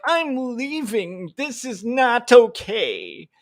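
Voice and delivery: high, very slow, prissy huff; mock huffy exit. Mocking critics who can't take pushback, high and drawn out. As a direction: storm off like a sulking child. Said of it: "they're like".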